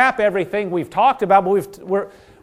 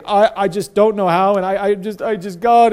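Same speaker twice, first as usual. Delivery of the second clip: whiny, halting mock self-pity, a bit louder; mock wallowing; 3 dB louder than usual. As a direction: stammer it out like someone wallowing in pity. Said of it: "and wallowing".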